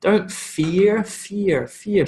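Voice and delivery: each FEAR isolated with its diphthong falling; combined vowel sound. Shown as a demonstration. Teacher giving examples of combined sounds, emphatic diphthong demo. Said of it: "these are combined sounds".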